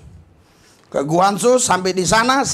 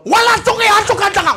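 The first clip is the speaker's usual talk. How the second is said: high, loud mock foreign officer yelling; hammed-up officer's shout; mock immigration officer; 13 semitones higher, 6 dB louder than usual. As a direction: bark like the officer at the red line. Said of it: "pas saya itu kan nginjak yang merah tuh".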